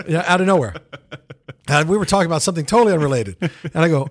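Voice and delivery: ordinary talk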